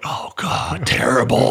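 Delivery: a private's frightened whisper; scared whisper. Retold or imitated. Private whispering in dread after the cadre singles him out. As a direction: whisper it like you just got singled out. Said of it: "Doing the scared private look".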